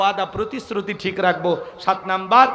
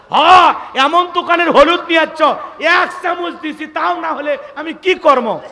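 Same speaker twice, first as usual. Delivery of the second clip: retorting wife; 8 semitones higher, 8 dB louder than usual. Retold or imitated. Wife snapping back at husband.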